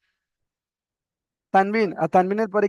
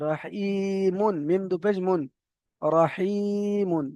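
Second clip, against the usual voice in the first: drawn-out recitation; much slower than usual. Shown as a demonstration. Teacher demonstrates Quran reading slowly.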